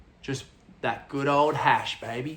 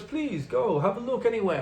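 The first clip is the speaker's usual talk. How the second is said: higher mock foreign-accented English; big head honcho; 8 semitones higher than usual. Farm boss letting visitor look around, imitated foreign accent. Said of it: "And he's talking English".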